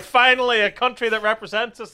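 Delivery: high, loud, acted celebration; mock relieved delight. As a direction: proclaim it like a long-awaited triumph. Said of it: "They were like".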